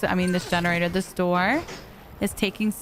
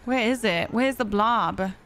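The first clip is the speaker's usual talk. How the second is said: ordinary talk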